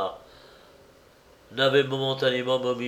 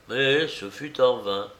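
ordinary delivery

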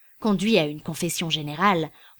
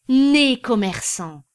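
slow, louder, each word weighed out; emphatic exclamation; 4 semitones higher, 6 dB louder, much slower than usual. A narrator's reading. César admiring his clerk, slow emphatic exclamation. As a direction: proclaim it like a verdict. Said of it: "dit César".

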